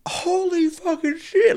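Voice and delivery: high, slow mock gasp of realization; stunned realization. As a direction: play the fan who finally gets the joke. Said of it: "is like".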